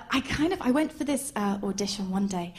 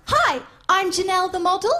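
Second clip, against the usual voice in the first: high, louder show-off introduction; put-on voice, pitched up; imitated model introducing herself; 7 semitones higher, 4 dB louder than usual. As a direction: announce yourself like a self-important model.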